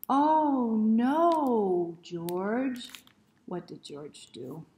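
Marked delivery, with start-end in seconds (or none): 0.1-2.9 loud sing-song exclamation; exclaiming. Shown as a demonstration. Reader leading children in the refrain, exclaiming loudly. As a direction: call it out big for the kids. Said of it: "You ready?".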